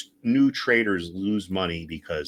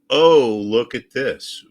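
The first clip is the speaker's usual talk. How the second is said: drawn-out, louder sarcastic oh; mock surprise; 4 semitones higher, 9 dB louder, much slower than usual. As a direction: feign amazement at the obvious. Sarcastic fake discovery on chart.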